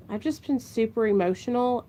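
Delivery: ordinary delivery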